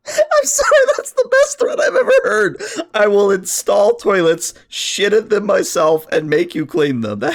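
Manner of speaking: high, loud words squeezed through laughter; laughing helplessly. Real, not acted. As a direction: repeat the threat while cracking up. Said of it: "You broke me".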